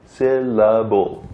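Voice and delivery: "Syllable" drawn out in three slow, even beats. Shown as a demonstration. Teacher recalling the chin exercise, "Syllable" slowly split in three. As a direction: sound out "Syllable" part by part, slowly. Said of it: "Remember we did the thing on our chin?".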